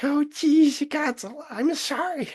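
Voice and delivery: put-on high, sheepish little boy; whiny kid voice. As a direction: apologize like a timid son. Voicing the son sheepishly.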